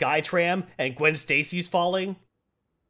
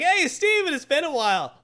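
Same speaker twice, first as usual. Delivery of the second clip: mock chummy greeting; 13 semitones higher, 3 dB louder than usual. Nightmare greeting Strange.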